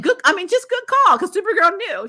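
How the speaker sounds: high, loud, thrilled approval; excited. Real, not acted. Vlogger cheering Supergirl's smart call, high and loud. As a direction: gush loudly over a smart move.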